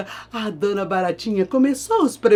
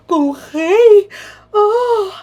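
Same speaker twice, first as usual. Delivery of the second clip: high, slow thrilled surprise; hammed-up surprise; mock delighted gasp; 14 semitones higher, 6 dB louder, much slower than usual. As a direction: gasp with flattered joy. Baratinha surprised.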